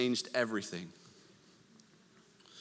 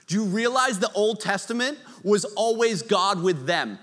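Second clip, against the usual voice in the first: normal talking voice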